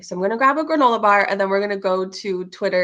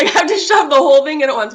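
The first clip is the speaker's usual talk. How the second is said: foodie style; 5 semitones higher, 10 dB louder, faster than usual. Acted. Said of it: "if I'm gonna do it foodie style".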